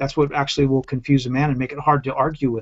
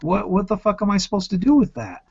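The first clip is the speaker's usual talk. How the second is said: exasperated; 7 semitones higher than usual. Retold or imitated. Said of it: "It's like".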